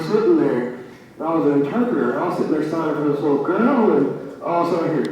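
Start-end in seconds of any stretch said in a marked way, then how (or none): none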